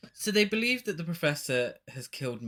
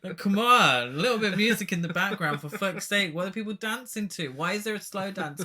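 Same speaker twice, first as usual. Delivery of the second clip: loud, fast, exasperated complaint; exasperated rant; 5 semitones higher, 6 dB louder, faster than usual. Real, not acted. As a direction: vent loudly at the silly scene. Ranting at the silent dance scene.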